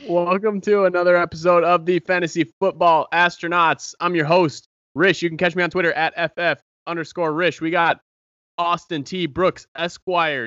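loud, high show-host intro; announcer voice. Acted. Host's announcer-style show intro.

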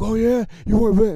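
high, loud jeering rival fan; mocking. Rival fan answering a fifty-buck bet, mocking. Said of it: "try to mock me".

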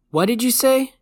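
loud, high, rising question; yelling. A narrator's reading. Kid going deaf to his doctor, yelling. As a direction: yell it as if you can't hear yourself. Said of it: "Brian basically yelled".

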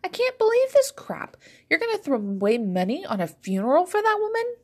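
very high incredulous outcry; appalled, heartbroken protest. A narrator's reading. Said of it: "half appalled and half heartbroken".

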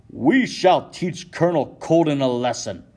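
Isaac Sears whipping up the Sons of Liberty, shouting; fierce shout. Acted. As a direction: roar it at the tavern crowd. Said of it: "shouts Isaac Sears".

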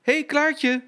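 high, loud call across the depot. Acted. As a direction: shout to wake a friend. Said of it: "Blauwe Katrientje riep".

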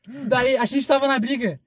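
normal talking voice

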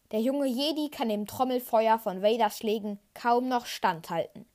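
usual speaking voice

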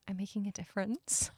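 soft, sweet murmur; sweetly under breath. Retold or imitated. Missus Hadley to herself in class, sweetly under her breath. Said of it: "really sweetly says under her breath".

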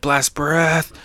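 mock battle call; attack call. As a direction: toss out the move name in a put-on voice. Calls out the attack name.

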